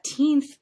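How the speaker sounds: put-on "teenth" loud and drawn out; longer teenth. Shown as a demonstration. Teacher pointing out the long part, "teenth" loud and stretched. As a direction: hold the vowel in "teenth". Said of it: "gonna sound a little bit longer".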